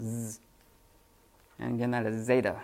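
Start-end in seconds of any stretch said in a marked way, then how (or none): 0.0-0.4 "Z" as a drawn-out buzz, zzz; low, soft, held zzz for "Z"; Z sound. Shown as a demonstration. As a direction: buzz the sound of "Z", not its name. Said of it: "it's the Z sound".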